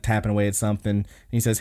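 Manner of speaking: normal talking voice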